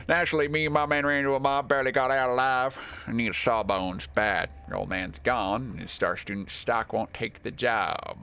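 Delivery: cowboy. Acted. Bad Grief begging a sawbones for his men, hammed-up cowboy voice. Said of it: "he thinks he's turned into a cowboy".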